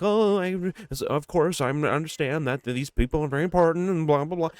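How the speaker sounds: high, mock-gracious player voice; polite interview voice. Imitating the star player in interviews, mock-polite. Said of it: "when she's in interviews and stuff".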